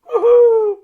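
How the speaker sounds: very high, loud woohoo; reading a pony's gleeful cheer. Acted. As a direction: whoop it out joyfully.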